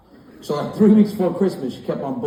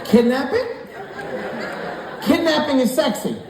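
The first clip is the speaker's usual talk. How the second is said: incredulous outburst; 10 semitones higher than usual. Acted. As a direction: throw it back in outraged disbelief. Indignant reply to wife's swooning.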